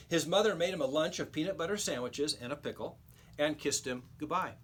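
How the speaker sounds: ordinary delivery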